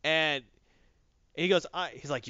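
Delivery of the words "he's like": usual speaking voice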